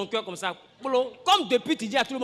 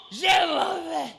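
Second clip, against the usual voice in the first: mock angry exit; 3 semitones higher, much slower than usual. Acts out angry leaving.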